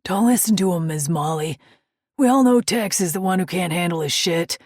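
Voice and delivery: loud, jokey biker voice; teasing biker. Acted. Biker teasing his friend in front of waitress, loud and jokey. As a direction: rib your buddy, good-humoured. Ice teases Tex.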